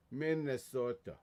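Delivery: put-on Minnesotan long O; Minnesota accent. Shown as a demonstration. Streamer showing his best Minnesota, Minnesota accent. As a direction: say it like a north Minnesota local. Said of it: "that's the best Minnesota I can do".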